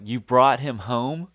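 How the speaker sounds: mock disbelief at the news; half-acted shocked "you brought him home". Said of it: "like, shocked".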